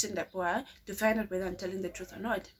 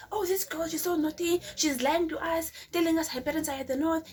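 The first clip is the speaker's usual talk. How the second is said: higher, mocking gossip voice, whispered start; mocking the aunt; 8 semitones higher than usual. Aunt gossiping to neighbors, mock voice. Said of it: "telling my neighbors that I'm naughty".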